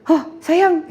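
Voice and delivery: a touch of a woken mother's surprise; startled mother. Retold or imitated. Mother woken by her daughter, mildly startled. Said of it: "mama aku kaget".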